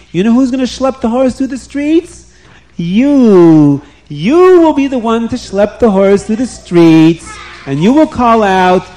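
king ordering Haman to lead the horse; commanding king. Acted. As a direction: drive the humiliation home.

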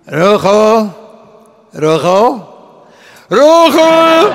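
calls of a name building to a high yell; shouting. Retold or imitated. Second patient crying out for the same Rochel, shouting. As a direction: call out repeatedly, loudest on the last. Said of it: "und er schreit".

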